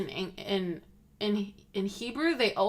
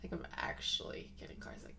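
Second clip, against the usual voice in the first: fast, much softer whispered complaint; 15 dB quieter, faster than usual. Real, not acted. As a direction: breathe it out quickly with no voice. Repeating car sickness complaint.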